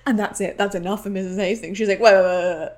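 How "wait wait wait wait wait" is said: louder, lower, firm stop; lower, louder mock mother stepping in; commanding interruption. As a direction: cut him off like an outraged mom.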